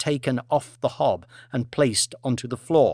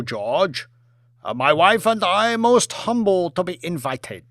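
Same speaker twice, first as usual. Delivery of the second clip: put-on polite premier in a Chinese accent; a formal Chinese dignitary thanking his host; 4 semitones higher than usual. Premier speaks.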